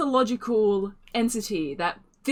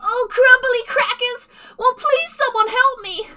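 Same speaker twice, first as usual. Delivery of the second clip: very high, loud, frantic gnome squeal; high, loud panic of a frazzled gnome; dramatic flustered gnome; 8 dB louder than usual. Acted. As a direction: fling it out like a melodramatic damsel. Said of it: "flails about the room dramatically and just goes".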